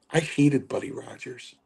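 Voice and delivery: ordinary delivery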